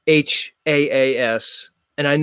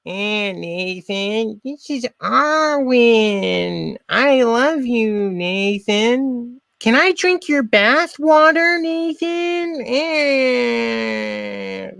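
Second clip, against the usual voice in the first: mocking Arwen, much higher and very slow, in a put-on voice; a drawn-out, lovesick parody, pitched up high; Arwen impression; 8 semitones higher, much slower than usual. Streamer mocks Arwen swooning over Nathan, high slow parody voice. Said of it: "let me try my Arwen impression".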